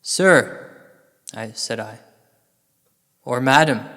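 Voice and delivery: ordinary delivery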